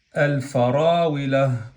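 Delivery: "al farawila" slow, L sounded before the f; lam pronounced. Shown as a demonstration. Teacher demonstrating the article before fa, slowly pronounced lam. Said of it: "hemos pronunciado la lam".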